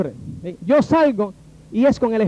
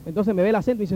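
normal talking voice

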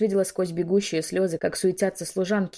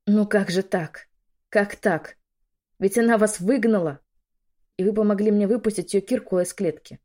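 higher, louder incredulous questioning; dismayed disbelief; 3 semitones higher, 5 dB louder than usual. A narrator's reading. Betrayed woman confronts the maid, dismayed disbelief. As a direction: protest in bewildered dismay.

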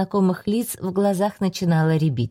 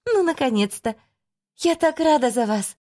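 high, delighted exclamation; joyful; 6 semitones higher than usual. A narrator's reading. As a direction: burst out with joy. Witch hears pregnancy news.

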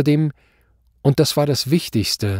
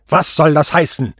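loud, higher shout; irate demand; 5 semitones higher, 7 dB louder than usual. A narrator's reading. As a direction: demand an explanation furiously. Judge demands explanation, enraged.

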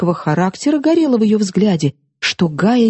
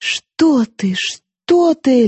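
babbling; 7 semitones higher, much slower than usual. A narrator's reading. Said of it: "лепетала Гая".

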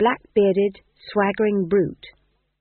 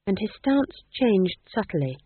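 plain reading voice

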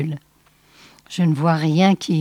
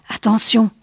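usual speaking voice

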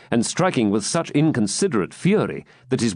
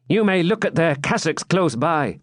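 higher, light-toned jester; 7 semitones higher than usual. A narrator's reading. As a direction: play it as the wry jester. Jester voiced in story.

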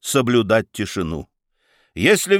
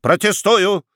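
sharp objection; 8 semitones higher, 4 dB louder than usual. Acted. Prosecutor objects in court.

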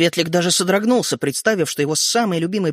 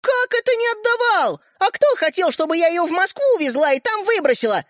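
very high, indignant cry; yelling girl; 13 semitones higher than usual. A narrator's reading. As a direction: argue back at a high pitch. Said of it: "закричала Ариша". (возмущённо крича)

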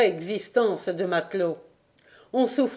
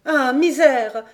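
high, loud cry of dismay; women on the quay crying out at bad news; dismayed exclamation; 6 semitones higher, 6 dB louder, slower than usual. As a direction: cry out in despair together. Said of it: "s'exclamèrent toutes les Bretonnes".

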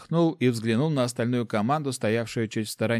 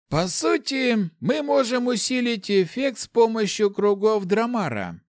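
high, slow character voice; high, drawn-out calculating voice; scheming mage; 12 semitones higher, 4 dB louder than usual. A narrator's reading. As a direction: think the plan out loud, pitched up. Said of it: "уже прикидывал в голове варианты Ларс".

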